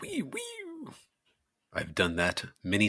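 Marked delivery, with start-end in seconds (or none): 0.0-0.9 very soft, high-pitched wee sounds; tiny whispered squeal in two bursts; imitated whirring noise.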